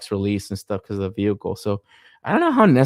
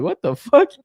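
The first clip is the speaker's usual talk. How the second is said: shocked reaction; 10 semitones higher, 6 dB louder than usual. Retold or imitated. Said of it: "I was like".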